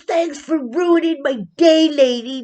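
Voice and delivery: high, loud snarky yell at the lady; imitating a cyclist yelling back at a nosy neighbor; retold yelling. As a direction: throw it at her like a sore loser. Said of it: "He starts yelling at me".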